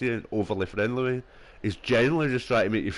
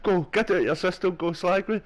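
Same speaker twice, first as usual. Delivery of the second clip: retold angry snap, pitched higher; a hammed-up outburst at a coworker; mock raging; 9 semitones higher than usual. Retelling his snap at a coworker, pitched-up mock rage. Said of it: "I was pure raging".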